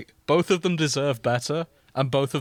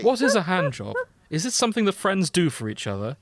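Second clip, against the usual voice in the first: lightly voiced curious Edwin, raised; a hint of Edwin asking innocently; demanding Edwin; 6 semitones higher, 4 dB louder than usual. Reading fanfic aloud, Edwin demands to know, lightly voiced. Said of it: "Edwin demands".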